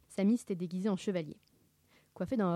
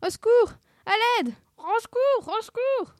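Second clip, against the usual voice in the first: very high, loud cartoon squeals; panicked cries; 9 dB louder than usual. Friends locked in a chest, squealing for help.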